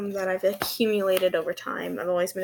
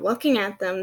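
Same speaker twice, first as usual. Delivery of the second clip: normal talking voice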